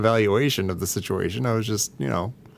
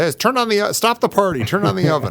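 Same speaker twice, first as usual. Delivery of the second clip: higher, eager call to the party; mock call-out; 9 semitones higher than usual. Retelling his own call to the party, higher and eager.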